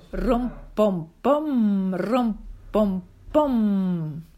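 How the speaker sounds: loud rhythmic thumping chant; threshing beat. Acted. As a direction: pound out the flail strokes. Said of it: "wie die Bauern ... das Korn droschen".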